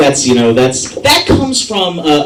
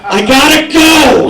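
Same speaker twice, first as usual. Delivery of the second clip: higher, louder, hurried driver panic; frantic Uber driver late for his quota, higher and louder; frantic rush; 9 semitones higher, 3 dB louder than usual. Retold or imitated. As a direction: rush off like every ride counts.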